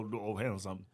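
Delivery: ordinary delivery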